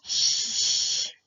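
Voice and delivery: long hissed ch with no voice; unvoiced ch sound; voiceless ch demo. Shown as a demonstration. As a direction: push air through for ch. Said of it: "Ahora la ch".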